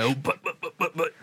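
rhythmic little patting chant; playful pet talk. Acted. Owner giving the dog big butt pats, playful chant. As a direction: bounce each "butt" like a pat.

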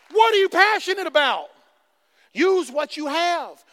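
loud, high preaching; fired-up sermon; full-force preaching. Acted. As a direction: preach it, building.

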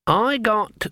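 put-on high old-woman voice; innocent old Granny. A narrator's reading. Granny showing her hand to sharpers, innocently. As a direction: pitch it up like a naive beginner.

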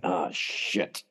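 low, soft imitated realisation; sobered muttering. He gets confronted and turns serious, low mutter. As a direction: drop it low and quiet. Acts out him turning serious.

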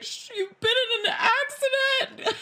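high panicked stammer. Retold or imitated. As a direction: blurt it out in a rising panic. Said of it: "I'm not good in a crisis".

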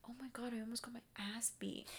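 fast, breathy whispered aside; a fast secretive whisper of disbelief; hushed whisper. Real, not acted. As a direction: lean in and whisper it quickly. Sudden aside about the party.

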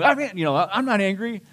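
loud, high, heated insistence; angry denial. Retold or imitated. Husband insisting to his wife he isn't angry, in loud mock anger. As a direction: protest too much, raising the voice. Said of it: "And then you get angry".

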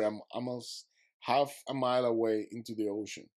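ordinary talk

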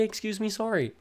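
high, light "Excuse me, sorry." falling at the end; polite apology. Shown as a demonstration. Telling ravers what to say, a high sweet apology. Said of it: "just say".

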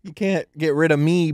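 normal talking voice

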